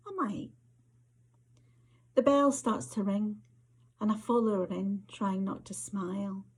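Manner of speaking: measured, intimate Scottish storytelling read; story narration. A narrator's reading. Author reading to an audience, story narration. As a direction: author reading her flash fiction aloud, unhurried and close. Reads her story aloud.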